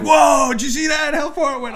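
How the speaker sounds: put-on high, shouting kids in awe; amazed kids. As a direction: yell it like a kid who just saw a home run. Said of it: "Those kids were like".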